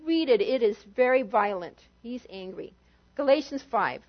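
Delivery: ordinary talk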